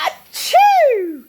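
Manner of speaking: loud hammed-up sneeze; big high achoo. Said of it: "he said with surprise".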